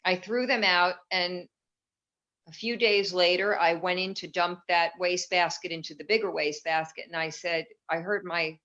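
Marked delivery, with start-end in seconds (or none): none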